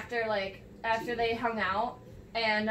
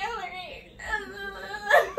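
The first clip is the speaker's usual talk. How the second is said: laughing; 7 semitones higher, much slower than usual. Retold or imitated. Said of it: "And I'm like".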